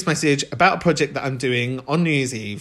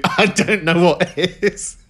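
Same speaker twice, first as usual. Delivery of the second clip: slow, chuckling through it; giggling at his own line; laughing; 3 semitones higher, slower than usual. Real, not acted. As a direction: laugh the words out. Laughing wrap-up.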